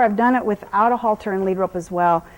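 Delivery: ordinary talk, nothing marked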